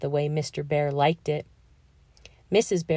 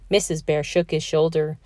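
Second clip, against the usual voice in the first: plain reading voice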